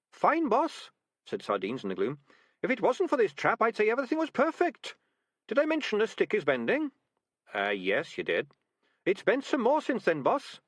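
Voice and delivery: very high, chirpy rat; a cheerful small rat in trouble; little rat voice. A narrator's reading. Sardines the rat in trap.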